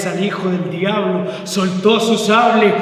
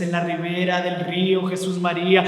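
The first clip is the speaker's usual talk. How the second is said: ordinary delivery, nothing marked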